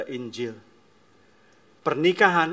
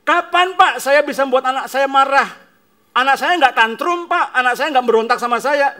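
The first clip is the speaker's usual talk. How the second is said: much louder, much higher protest; indignant parent; 12 semitones higher, 14 dB louder than usual. Acted. Defensive parent objecting to the pastor, loud.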